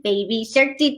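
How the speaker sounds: usual speaking voice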